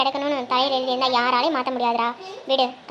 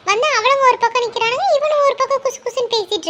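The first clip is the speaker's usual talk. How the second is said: higher, louder auntie spilling gossip; gossiping village woman; 7 semitones higher, 4 dB louder than usual. Acted. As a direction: tell it with relish, pitched up. (உயர்ந்த குரலில் வம்பு பேசும் பெண்)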